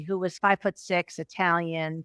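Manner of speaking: ordinary talk, nothing marked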